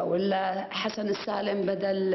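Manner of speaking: ordinary talk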